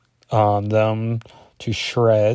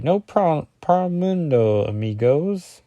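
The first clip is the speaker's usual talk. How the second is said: high, drawn-out fake Spanish accent; bad Spanish accent; 6 semitones higher, slower than usual. Host voicing the caravan driver in a bad Spanish accent. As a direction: put on an atrocious Spanish accent. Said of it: "my Spanish accent. Atrocious".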